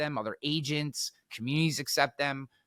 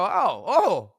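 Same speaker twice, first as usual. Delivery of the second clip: higher, louder feigned surprise; mock realization; 7 semitones higher, 6 dB louder than usual. Speaker answering the backpedalling heckler, mock surprise. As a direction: pretend to be suddenly enlightened. Acting his comeback to heckler.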